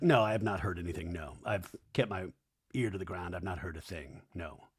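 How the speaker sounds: low, fading mock-innocent denial; mock hushed denial. Podcaster playing Doty denying any rumors, low fading voice. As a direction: start low and trail down to nearly nothing.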